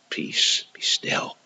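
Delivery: hushed, calm command to the storm; whispered command. Retold or imitated. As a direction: still the sea with a whisper. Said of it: "he just said to that".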